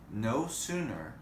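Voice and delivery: plain reading voice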